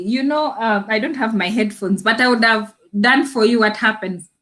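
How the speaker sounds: ordinary talk, nothing marked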